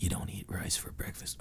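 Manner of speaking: ASMR whisper. Pretend. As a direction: draw the words out softly into the mic, ASMR style. Continuing ASMR parody.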